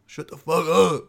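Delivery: a hint of a cranky old man snapping back; mock snarl at a nosy shopper; lightly voiced grumpy elder telling them off; rude old-man retort. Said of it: "You're just like".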